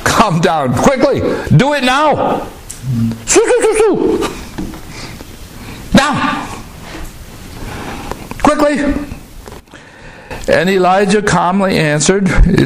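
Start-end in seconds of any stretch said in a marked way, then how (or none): 0.0-8.9 much higher, barked commands; hammed-up bossy captain, hurry him; captain ordering the prophet down at once, mock impatient. Mocking the captain's demand.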